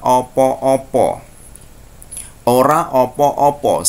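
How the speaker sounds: slow, full formal Javanese "Ora opo-opo". Shown as a demonstration. Teacher showing students the full formal Javanese form. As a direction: spell out "Ora opo-opo" slowly. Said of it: "bahasa Jawa yang resmi".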